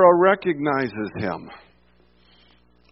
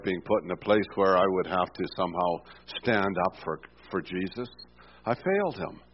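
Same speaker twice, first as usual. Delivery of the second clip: quiet confession; 6 semitones lower, 4 dB quieter than usual. Real, not acted. Preacher confesses his own failures.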